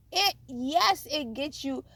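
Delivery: ordinary talk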